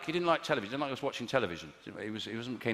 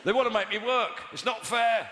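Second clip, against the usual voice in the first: mock heckler; 7 semitones higher, slower than usual. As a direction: shout nonsense like a lunatic at a bus stop. Imitating random shouters.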